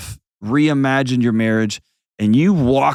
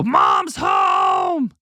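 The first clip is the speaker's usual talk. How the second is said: put-on excited kid shout; 8 semitones higher than usual. Child greeting mom at the front door, excited higher shout.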